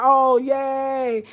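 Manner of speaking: high and loud little cheer; real delight; excited cheer. Real, not acted. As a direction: celebrate them joining. Cheering a viewer joining.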